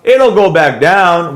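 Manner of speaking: ordinary delivery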